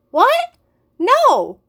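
high, loud startled denial; boy protests being accused of love notes, loud and high; shocked protest. Acted. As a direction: yelp it out in disbelief. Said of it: "I protested".

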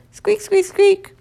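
high, bright little hamster squeaks; squeaking hamster. A narrator's reading. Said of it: "all that came out was".

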